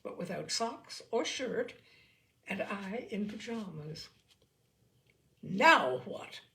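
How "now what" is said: loud, stern demand; grim demand. Acted. Mother breaking up the scuffle, loud and grim. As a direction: cut through the noise with a sharp demand. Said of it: "demanded my mother grimly".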